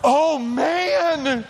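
ordinary talk, nothing marked

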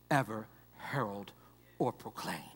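fading to a whisper; hushed awe; reverent whisper. Acted. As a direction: let the words fall away to breath. Trails into a whisper.

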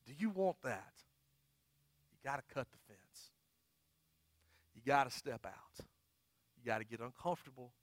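soft, low, earnest preaching; hushed pastoral appeal. Real, not acted. Pastor urging people to get unstuck, hushed appeal. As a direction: bring it right down and plead quietly.

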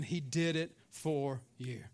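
low and slow, DID IT, FOR, YOU set apart; solemn emphasis. Real, not acted. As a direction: space out "did it for you" slowly and softly. Repeats it slowly for emphasis.

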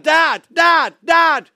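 loud, high calls for Dad; lost child shouting for his father. Retold or imitated. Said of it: "I start shouting out".

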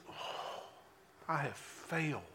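a breathy, barely audible admission of failure; hushed, heartbroken "I have failed"; broken whisper. Retold or imitated. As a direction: let it fall out of him, crushed. Peter realises his failure.

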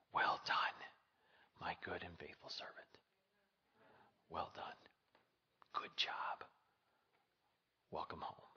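soft unvoiced whisper, very gentle; tender whisper. Jesus whispering to the faithful servant, tender whisper. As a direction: whisper it like a loving welcome home.

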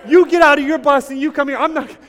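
high, loud retold yelling out the window; road rage shouting. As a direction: shout at the bus driver with a laugh breaking in. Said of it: "yelling at him".

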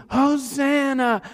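high, slow shouted cheer; crowd's cheer. Retold or imitated. Crowd lining the street for Jesus, shouted cheer.

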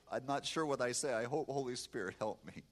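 ordinary talk

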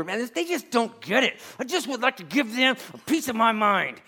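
hammed-up indignant complaining; mock frustrated rant. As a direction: vent like someone fed up with the boss. Voicing frustrated churchgoer.

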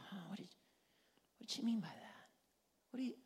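hushed, puzzled musing; thinking to oneself. Acted. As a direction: wonder it under your breath. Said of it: "begin to think and go".

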